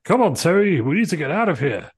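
loud, high urgent escape call. Acted. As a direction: hurry him out, loud and high. Escaping the villain.

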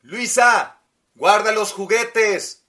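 loud mother calling from far; calling from kitchen. Acted. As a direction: shout the order across the house. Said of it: "dijo la madre de la niña desde la cocina".

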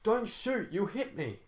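high-pitched plea from a captive guard; cursing captive. A narrator's reading. Captive guard begging the riflemen not to fire, high-pitched cursing. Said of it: "Her captive guard cursed".

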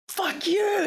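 high yelled curse at another driver; mock road rage yell. Driver yelling at another car, mock yell. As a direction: shout it like a furious driver.

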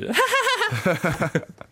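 ridiculous high-pitched laugh; Chris Gayle's laugh. Retold or imitated. Imitating a cricketer's laugh, absurd high cackle. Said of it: "his laugh is ridiculous".

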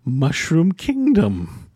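grand hermit voice. Acted. Hermit names himself.

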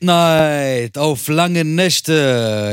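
slow, drawn-out ring announcer hype; Bruce Buffer. Acted. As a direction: stretch every word like a fight announcer. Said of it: "Bruce Buffer".

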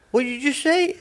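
high, startled challenge; baffled stranger snapping back; incredulous demand. Retold or imitated. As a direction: demand it like a stranger caught off guard. Confused guy confronting in supermarket.

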